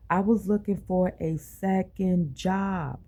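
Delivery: usual speaking voice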